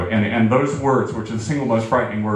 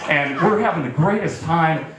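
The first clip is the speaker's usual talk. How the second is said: ordinary delivery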